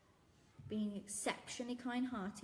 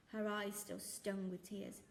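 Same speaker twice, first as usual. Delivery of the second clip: ordinary delivery, nothing marked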